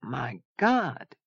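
soft, high, amazed; hushed awe. A narrator's reading. Zona staring up at Saint Mark's, awed. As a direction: breathe out the exclamation in wonder.

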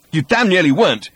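loud, high outburst; explosive. A narrator's reading. Ford rounding on Arthur, exploding. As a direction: explode in exasperation. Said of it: "exploded Ford".

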